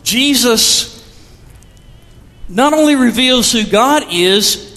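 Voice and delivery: ordinary delivery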